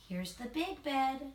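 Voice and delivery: usual speaking voice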